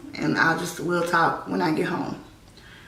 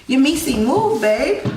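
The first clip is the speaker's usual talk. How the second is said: calling out; 8 semitones higher, 5 dB louder than usual. Real, not acted. Storyteller interrupting herself to move Missy along, calling out.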